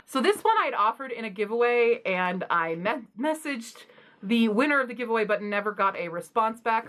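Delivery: ordinary delivery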